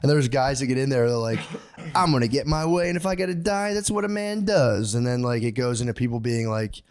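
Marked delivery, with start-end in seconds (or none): 1.9-4.9 macho bravado. Retold or imitated. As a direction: play a cocky fifties man proving he's tough. Macho fifties essay writer quoted.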